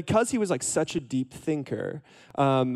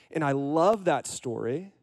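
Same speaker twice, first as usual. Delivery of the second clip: usual speaking voice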